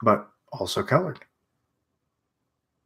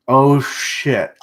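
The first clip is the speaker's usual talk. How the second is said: plain reading voice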